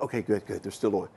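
soft, hushed relief; relieved murmur. Retold or imitated. Boy waking at night, checking the lights, softly relieved. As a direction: quietly reassure yourself in the dark. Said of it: "I could look".

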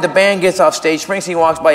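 ordinary delivery

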